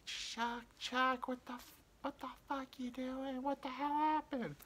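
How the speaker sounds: high, thin-volume imitation of a frantic mother; freaking-out mom. Mom questions dad as he comes home bloody, mock freak-out. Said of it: "my mom's freaking out".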